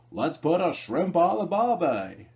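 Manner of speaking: high, loud, slow hammed-up Australian accent; bad Australian accent. Reviewer quoting his favorite movie line in a bad Australian accent. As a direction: drag it out in a cheesy fake Australian accent. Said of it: "that's shitty Australian accent".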